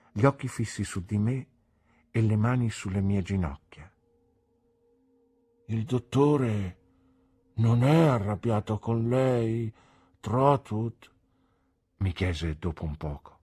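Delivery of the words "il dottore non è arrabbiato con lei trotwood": put-on slow, anxious simple old man; a hint of a worried childlike man; mister Dick. A narrator's reading. Simple old man anxiously asks the boy about the doctor.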